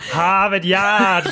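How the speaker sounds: put-on slow, drawn-out Boston accent, r dropped. Teasing Sarah's Boston teacher, in a Boston accent. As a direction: lean hard into a Boston vowel on Yard. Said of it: "full-on Boston accent".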